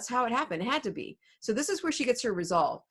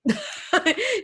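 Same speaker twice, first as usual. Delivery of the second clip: a high amused laugh at the plot; high burst of laughter; 10 semitones higher, 4 dB louder than usual. Real, not acted. Teacher laughs at Juliet deciding to die.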